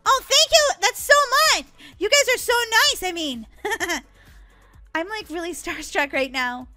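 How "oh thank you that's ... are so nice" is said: high, loud, flattered delight; gushing thanks. Acted. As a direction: thank your fans, overexcited. Thanking admiring fans.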